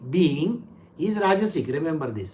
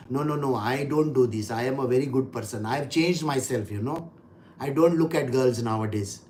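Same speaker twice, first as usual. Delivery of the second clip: reformed man; 8 semitones lower, 5 dB quieter than usual. Retold or imitated. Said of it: "who is saying".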